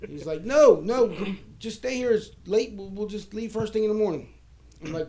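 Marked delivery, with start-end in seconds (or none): 0.4-4.3 high, insistent retold plea; urging protest. Friend talks him out of leaving, high insistent urging. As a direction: voice a friend pushing him to stay.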